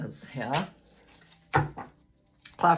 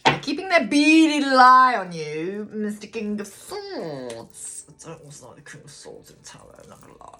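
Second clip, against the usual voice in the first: half-mysterious, half-mocking reading of the card; odd put-on voice; 4 semitones higher, 6 dB louder than usual. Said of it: "I don't know why I'm talking like that".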